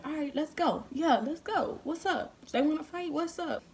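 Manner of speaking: high-pitched, eager call to fight; fired-up challenge. Retold or imitated. Girl ready to fight whoever is outside, fired-up challenge. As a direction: hype yourself up to take them on.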